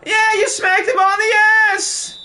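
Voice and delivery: very high, loud mock outrage; over-the-top yelled tease; squealing shout. Real, not acted. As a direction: shriek the accusation for laughs. Mocking a foul.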